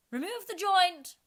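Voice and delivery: high, loud imperious shout; commanding order. A narrator's reading. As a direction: order the waiters away. Red Queen orders waiters.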